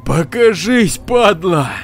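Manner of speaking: loud, high challenging yell; defiant shout. Acted. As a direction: shout to hide the fear. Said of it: "крикнул". (вызывающий крик)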